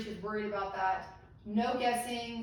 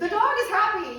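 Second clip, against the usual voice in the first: much higher and louder, bursting with delight; delighted exclaim about a happy dog; 11 semitones higher, 10 dB louder than usual. Real, not acted. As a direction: cry it out with glee. Laughing about dog picture.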